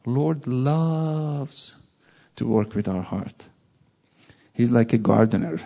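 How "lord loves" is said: ordinary talk, nothing marked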